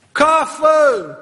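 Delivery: high, loud desperate shout for coffee; hammed-up desperate yell for coffee; desperate mock cry. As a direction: wail for coffee like an addict. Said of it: "E un strigă disperat".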